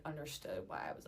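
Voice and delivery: ordinary delivery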